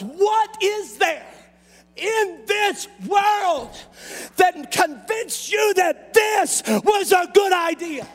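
preacher pressing the church on selling out; preaching at full force. Acted. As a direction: hammer the question at the congregation. Preacher drives the question home.

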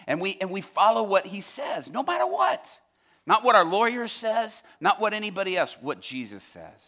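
loud, rising pulpit insistence; full-force preaching. Real, not acted. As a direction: drive each point home to the congregation.

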